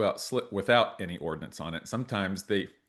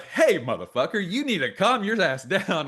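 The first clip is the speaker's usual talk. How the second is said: raised-pitch mock scolding; retold telling-off; 8 semitones higher than usual. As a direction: act out superiors jumping in to put someone in their place. Retold telling-off by his superiors.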